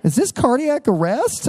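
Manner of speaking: high-pitched, hammed-up alarm; halting, then climbing into mock panic; nervous. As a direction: play a worried listener on the phone. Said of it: "I get nervous".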